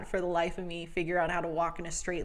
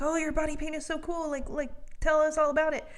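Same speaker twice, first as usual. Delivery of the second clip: gushing fan voice; 8 semitones higher, faster than usual. Retold or imitated. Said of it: "people are coming up to me like".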